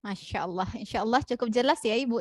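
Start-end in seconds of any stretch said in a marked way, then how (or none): none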